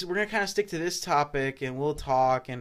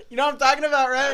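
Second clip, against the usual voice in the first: laughing; 9 semitones higher, 3 dB louder, faster than usual. Real, not acted. Still laughing about topic.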